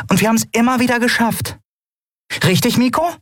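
high-pitched small voice; rally them in a very high voice; encouraging Nelen. Acted. Nelen seeking Miko's agreement, high encouraging voice.

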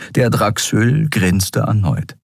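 usual speaking voice

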